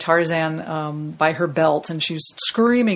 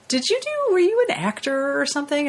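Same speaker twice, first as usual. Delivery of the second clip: quoting high; 9 semitones higher than usual. Retold or imitated. Retold phone call, quoting caller.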